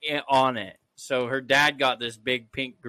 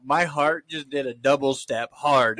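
ordinary delivery

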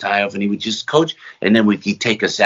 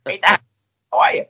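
Marty Feldman impression; 10 semitones higher than usual. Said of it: "like, uh, Marty Feldman".

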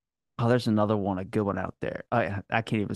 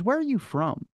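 higher-pitched curious question; quoted researcher; 10 semitones higher than usual. Retold or imitated. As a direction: lightly voice a woman asking, pitched up. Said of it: "and she goes".

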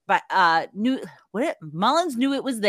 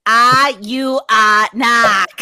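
mock Boston drawl; 3 semitones higher, 9 dB louder, much slower than usual. Mocking Boston knock.

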